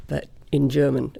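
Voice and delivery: normal talking voice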